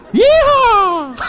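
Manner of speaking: high laughing cowboy whoop; yeehaw holler. Real, not acted.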